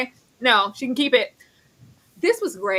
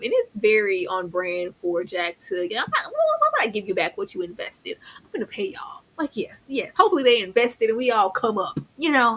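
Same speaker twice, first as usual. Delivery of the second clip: ordinary talk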